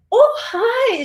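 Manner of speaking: high, bright mock surprise; a lady caught off guard by a wave, pleasantly surprised. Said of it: "She was surprised".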